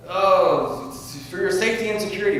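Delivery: high, loud mock official reassurance; mock official telling people it's for their own good. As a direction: sneer it like a smug agent selling safety. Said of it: "jump out and say".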